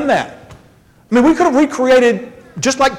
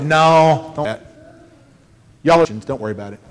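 mock reassurance; 8 semitones lower, faster than usual. Jerusalem Council settling worried believers, low casual reassurance.

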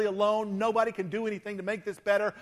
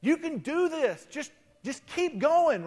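higher, bright cheerleading encouragement; upbeat pep talk; 7 semitones higher than usual. Retold or imitated. Pastor mockingly cheering on a burned-out friend, upbeat. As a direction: pump a weary friend up with cheer. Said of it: "and I tell you".

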